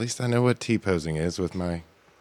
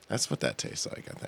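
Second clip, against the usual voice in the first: whispered; 7 semitones lower, 11 dB quieter than usual. Real, not acted. Whispered aside.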